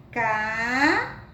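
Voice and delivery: "Casa" said slowly, syllable by syllable; syllable demo. Shown as a demonstration. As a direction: stretch out "Casa" to show its two syllables. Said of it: "e sim um K". ("Casa" bem pausado, sílaba por sílaba)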